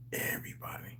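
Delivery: a breathy whispered word. Real, not acted. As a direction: whisper it like a secret. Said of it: "whispered emphasis".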